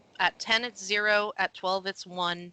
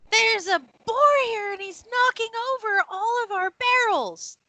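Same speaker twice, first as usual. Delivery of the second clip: high, loud, frantic cry; shrill alarm; 13 semitones higher, 5 dB louder than usual. Acted. Desiree answers about a rampaging boar, shrieking in alarm. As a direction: shout back in a high-pitched fluster.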